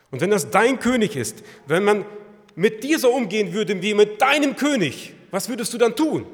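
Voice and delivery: loud, high, urgent preaching; preaching at full force. Real, not acted. Preacher pressing the congregation, loud and rising. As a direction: drive the questions home to the listeners.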